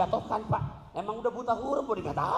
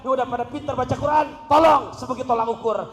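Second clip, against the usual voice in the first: preaching loudly; 8 semitones higher, 10 dB louder than usual. Real, not acted. Preacher building to a climax.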